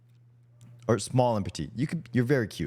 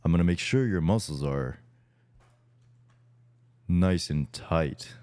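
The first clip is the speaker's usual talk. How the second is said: pitched-down bro voice, as his friend asked; deeper voice; 10 semitones lower than usual. Shown as a demonstration. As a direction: drop it down for the act. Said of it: "say it with a deeper voice".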